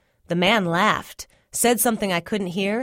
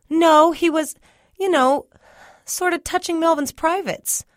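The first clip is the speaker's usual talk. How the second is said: nervous; 11 semitones higher, 5 dB louder than usual. Acted. Embarrassed boy tells brother.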